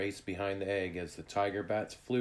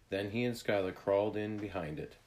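ordinary delivery, nothing marked

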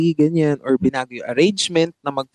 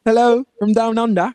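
put-on playful, higher Australian-accented greeting; mock Australian accent; 6 semitones higher than usual. Singer hailing listeners down under in a mock Australian accent. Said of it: "Sydney, Australia".

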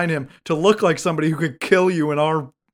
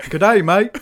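higher mock Australian greeting; bad Australian accent; 4 semitones higher, 4 dB louder than usual. Terminator trying to be Australian, bad Australian accent. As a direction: try a shoddy Australian accent. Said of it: "trying to also be Australian".

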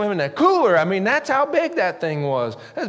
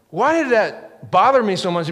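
ordinary talk

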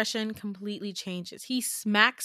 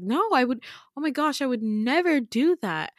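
shocked denial; 5 semitones higher, 6 dB louder than usual. Retold or imitated. Retold denial of an accusation.

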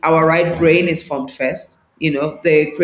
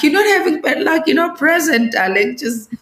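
mock teasing scold; 8 semitones higher than usual. Gently scolding the imagined person.

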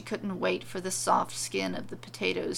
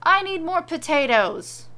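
louder, higher, pushy wife's voice; demanding wife; 7 semitones higher, 8 dB louder than usual. Acted. Witch wife demanding more potatoes from husband, pushy. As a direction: insist on it like a spoiled witch.

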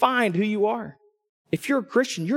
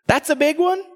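mock disbelief; 8 semitones higher than usual. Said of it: "I'm like".

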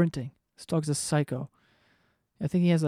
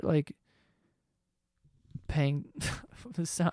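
ordinary talk, nothing marked

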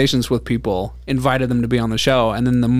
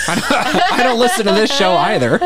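higher, slow, laughing tease; 8 semitones higher, slower than usual. Real, not acted. Teasing through laughter.

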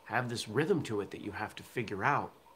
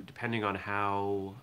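normal talking voice

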